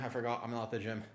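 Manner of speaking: usual speaking voice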